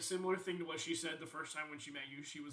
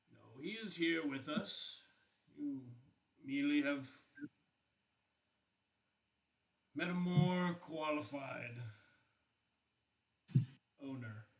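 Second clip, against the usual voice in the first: slow, deliberate pronouncement; lightly voiced solemn figure. Game master's character answers the helmet, slow and deliberate. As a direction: draw it out with weighty pauses.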